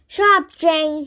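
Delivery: shout. A narrator's reading. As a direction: snap it loudly at her friend. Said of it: "shouted Natasha".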